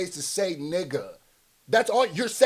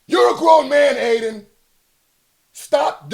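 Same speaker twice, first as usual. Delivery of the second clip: louder, higher rebuke; exasperated scolding; 6 semitones higher, 11 dB louder than usual. Real, not acted. Streamer scolding Aiden, loud exasperated rebuke. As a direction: tell him off, fed up.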